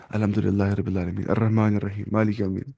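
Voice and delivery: "الحمدللہ رب العالمین" in a Kashmiri accent, plain h, no throat sounds; Kashmiri-accented recitation. Shown as a demonstration. Teacher shows students what to avoid, Kashmiri-accented recitation. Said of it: "ویسے وہ کشمیر والا قرآن جو پڑھتے ہیں".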